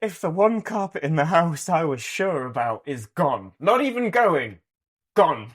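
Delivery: high, loud comic exasperation; mock dismay. Man recounting his dismay at the ripped-up carpet, mock-outraged. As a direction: protest in exaggerated disbelief.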